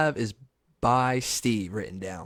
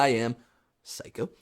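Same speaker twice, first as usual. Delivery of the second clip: ordinary delivery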